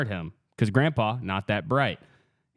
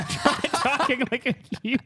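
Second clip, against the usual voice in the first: laughing; 12 semitones higher than usual. Real, not acted. Reads retold line amid laughter.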